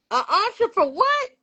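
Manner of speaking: high-pitched, incredulous question; baffled granny. Retold or imitated. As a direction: imitate a granny who thinks it's crazy. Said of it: "She thought I was crazy".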